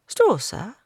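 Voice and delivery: put-on high, meek girl's apology; small girl caught out; timid girl. A narrator's reading. Girl character voice.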